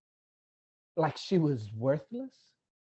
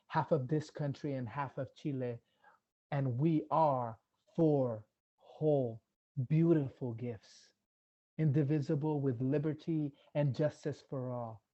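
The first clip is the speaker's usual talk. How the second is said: ordinary delivery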